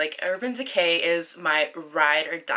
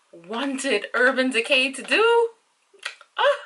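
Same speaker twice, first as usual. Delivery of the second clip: overwhelmed; 7 semitones higher, 5 dB louder, slower than usual. Real, not acted. Emotional over dream product.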